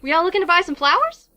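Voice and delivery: southern florist to customers; southern accent. Acted. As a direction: pitch the shop in a lifted southern voice. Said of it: "doing a southern accent for these characters".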